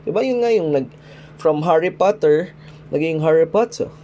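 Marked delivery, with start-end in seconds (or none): 1.6-2.5 "Harry Potter" with an American r ending "Potter"; American accent. Shown as a demonstration. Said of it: "American English accent". 3.2-3.9 "Harry Potter" in British English, no r ending "Potter"; British accent. Shown as a demonstration. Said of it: "British accent naman siya".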